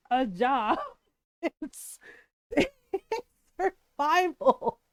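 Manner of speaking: laughing through the words; real laughter. Real, not acted. Joking about what parenting is, cracking up. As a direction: break up laughing mid-sentence.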